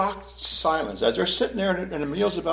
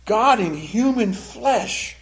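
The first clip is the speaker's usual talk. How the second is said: ordinary talk, nothing marked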